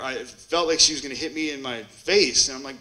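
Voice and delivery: ordinary delivery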